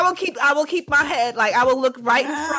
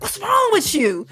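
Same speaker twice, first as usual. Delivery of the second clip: exasperated yell; 8 semitones higher than usual. Retold or imitated. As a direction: let it out at the screen. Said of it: "I do yell sometimes at the email".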